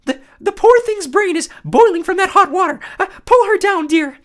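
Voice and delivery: very high, loud fake lady voice; nervous falsetto bride. Grover disguised as the bride stalling the Cyclops, falsetto. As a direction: squeak it out like a frightened bride.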